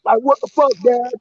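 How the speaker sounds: high, mock-whiny complaining son. Man mimics the son locked out by his dad, high and whiny. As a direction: imitate the kid's indignant whine.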